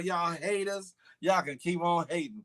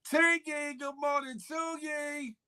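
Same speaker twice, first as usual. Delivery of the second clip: playful greeting; 9 semitones higher, 3 dB quieter, slower than usual. Acted. Greeting caller in put-on voice.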